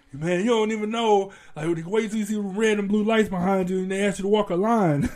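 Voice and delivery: salty retort. Retold or imitated. Annoyed friend lecturing about police lights, mild mock anger.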